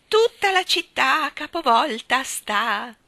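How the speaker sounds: high, loud, drawn-out exclamation; joyous cry. A narrator's reading. Miss Tartina's cries echoing through the room, joyous cry. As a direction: call it out slowly in high glee. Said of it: "le grida gioconde della signorina Tartina".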